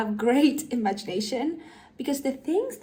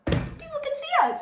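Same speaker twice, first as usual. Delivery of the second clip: mock panic. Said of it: "imitating character's thought".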